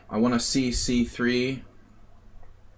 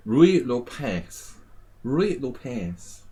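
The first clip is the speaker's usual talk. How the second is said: slow, drawn-out Spanish pronunciation; put-on Spanish accent, stretched vowels; 3 semitones higher, much slower than usual. Shown as a demonstration. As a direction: roll it out like a Spaniard, slowly. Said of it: "say it with a Spanish accent".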